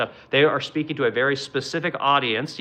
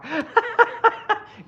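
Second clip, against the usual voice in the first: high laugh. Real, not acted. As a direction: burst of high-pitched laughter. Laughs about K Bunny.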